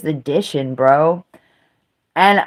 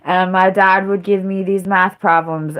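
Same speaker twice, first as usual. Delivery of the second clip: ordinary talk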